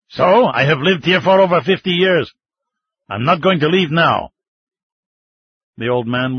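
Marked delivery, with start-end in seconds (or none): 0.1-4.3 higher, louder stubborn refusal; defiant old man. Acted. Old man to the mining chief, loud defiant refusal. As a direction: dig in and refuse to budge.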